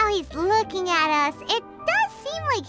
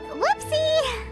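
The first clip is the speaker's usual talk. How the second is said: high, drawn-out "oops" of embarrassment; sheepish whoopsie; 10 semitones higher than usual. Acted. Companion owning up to wrongly accusing a suspect, sheepish. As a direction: squeak it out like a caught-out sidekick.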